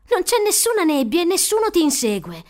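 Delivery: emphatic, exasperated reassurance; firm protest. A narrator's reading. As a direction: push back loudly to break through his panic. Maya firmly contradicts frightened boy.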